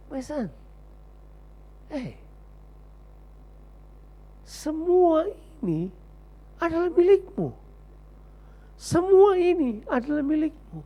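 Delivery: gently, heartbroken; sad tender father. Acted. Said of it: "Bapaknya sedih banget".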